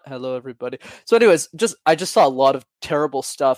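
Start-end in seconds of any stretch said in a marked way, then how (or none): 0.1-0.8 dull monotone. Retold or imitated.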